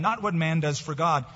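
ordinary talk, nothing marked

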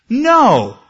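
loud, high, final refusal; God's booming no; God's firm no. Retold or imitated. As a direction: slam the door with a loud high "No.". God refusing Paul again.

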